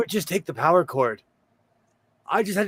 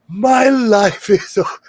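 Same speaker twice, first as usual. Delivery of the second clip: loud, slow, drawn-out lament; hammed-up despair, about to crack up; mock wail; 4 semitones higher, 8 dB louder, slower than usual. Dad imitating son who lost his phone data, mock wail. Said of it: "he was big crying".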